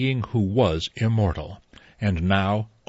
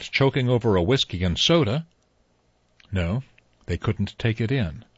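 ordinary delivery, nothing marked

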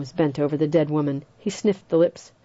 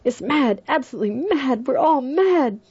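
higher and rising, almost shrill; violent outburst; 9 semitones higher than usual. A narrator's reading. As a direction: lose his nerve and lash out. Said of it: "He said violently".

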